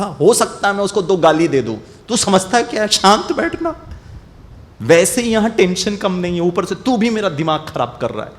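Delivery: retelling losing his temper at a friend; angry outburst. Retold or imitated. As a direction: snap at the pestering friend. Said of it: "अब क्रोध action में आ गया".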